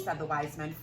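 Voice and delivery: ordinary delivery, nothing marked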